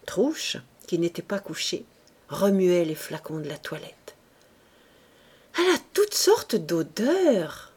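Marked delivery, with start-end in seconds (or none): none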